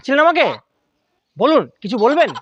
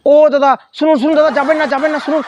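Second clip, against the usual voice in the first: calling after someone; 8 semitones higher than usual. Acted. Pleading, calling after a passerby.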